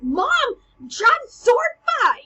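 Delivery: high, loud exasperated whine; whiny annoyed teen. Acted. Hamlet brushing off his mother mid-fight, whiny teen. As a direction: whine like an embarrassed teenager.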